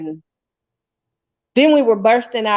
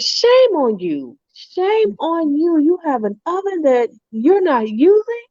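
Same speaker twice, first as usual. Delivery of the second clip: mock scolding; 9 semitones higher than usual. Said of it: "He says".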